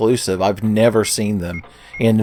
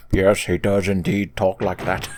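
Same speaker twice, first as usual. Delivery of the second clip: lower, put-on stuffy villain voice; mock Wesker, pitched down slightly; Wesker impression; 3 semitones lower, 6 dB quieter than usual. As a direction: answer as a dry, formal villain. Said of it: "And Wesker's like".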